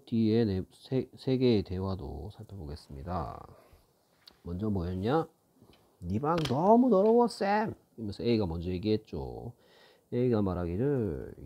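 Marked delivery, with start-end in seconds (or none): 6.0-7.7 voicing character. Teacher voices character A.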